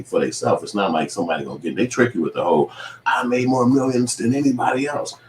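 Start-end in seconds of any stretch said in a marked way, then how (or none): 3.0-5.1 higher-pitched mocking brag; mock boast. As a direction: parody someone bragging about money.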